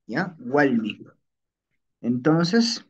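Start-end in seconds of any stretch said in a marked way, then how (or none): 0.4-1.0 Walmi with l in place of r; L variant. Shown as a demonstration. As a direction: say Walmi as "WAL-mi". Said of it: "sino decimos".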